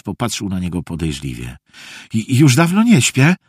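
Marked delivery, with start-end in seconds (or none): 2.1-3.4 mildly overeager, higher voice; eager assurance. A narrator's reading. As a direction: assure a suspicious grandfather. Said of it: "zapewnił Felek". (gorliwe zapewnienie, nieco wyżej)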